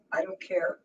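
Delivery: usual speaking voice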